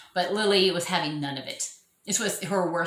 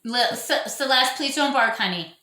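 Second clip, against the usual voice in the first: raised voice calling the dog off, then softening on honey; 6 semitones higher, 4 dB louder than usual. Real, not acted. Owner calling her barking dog Celeste to stop, raised then gentle. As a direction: call across the room to the barking dog.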